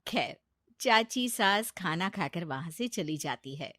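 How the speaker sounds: plain reading voice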